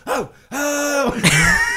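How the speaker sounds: high, loud startled oh, oh; alarmed cry. Retold or imitated. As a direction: yelp as you start to slip. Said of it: "I was like".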